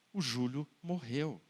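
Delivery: low, soft, grave aside; solemn refrain. Acted. Preacher reminding the crowd the boy is dead, low and soft. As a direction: drop the voice low and quiet, heavy.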